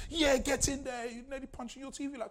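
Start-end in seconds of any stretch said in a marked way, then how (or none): 0.1-1.1 high, cheering fan at the telly; mock sports cheer. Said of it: "Even answer watching sports".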